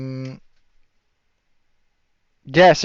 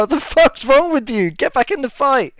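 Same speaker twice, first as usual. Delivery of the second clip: much higher, aggressive yelling mates; angry mates; 10 semitones higher than usual. Acted. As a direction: shove him back into the fight. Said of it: "the other four of his mates be like".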